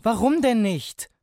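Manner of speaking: put-on high, loud, drawn-out child's protest. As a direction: wail it like a child refused. Child protests father's refusal.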